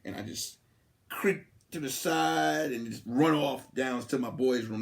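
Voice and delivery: normal talking voice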